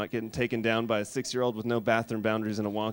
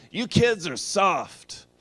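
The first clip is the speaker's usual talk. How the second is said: put-on high, loud, slow scolding old lady; stern grandma lecture; tough grandma; 5 semitones higher, 5 dB louder, slower than usual. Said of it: "my grandma's always saying".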